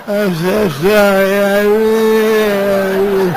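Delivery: put-on loud, drawn-out, high drunken wail; hammed-up blubbering apology, slow and loud; mock drunken crying. Crying drunk begging forgiveness, mock wailing. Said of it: "crying drunk. God, they're sickening".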